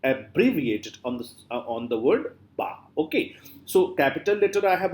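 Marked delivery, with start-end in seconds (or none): 0.0-1.0 "Abbreviated" with bre lifted out; stress on bre. Shown as a demonstration. Teacher answering where the focus is, stress on bre. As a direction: lean on the second syllable of "Abbreviated". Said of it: "where are you putting your emphasis and focus".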